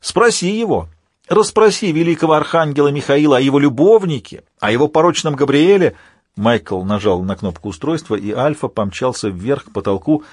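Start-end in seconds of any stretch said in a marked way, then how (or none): 0.0-6.0 high acted villain's cry, pushed out; narrator's raving, high-pitched shouter; shouted revelations. A narrator's reading. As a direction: call out a taunting accusation at raised pitch. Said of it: "Альфа выкрикивал свои откровения".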